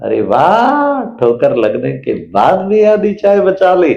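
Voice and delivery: high, slow, beaming "अरे वाह"; husband praising wife for saving half the tea; delighted praise. Retold or imitated. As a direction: cheer her on, full of delight. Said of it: "number three".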